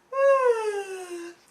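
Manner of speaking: high, loud waking-up sigh; stretching yawn. A narrator's reading. Goldilocks wakes and stretches, loud high sigh. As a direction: let out a big acted stretch noise. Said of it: "she has a stretch".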